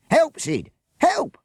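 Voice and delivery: high loud scream for help. Acted. Bert pinned by children, screaming to Sid. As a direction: shriek for your partner to save you. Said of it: "Bert screamed".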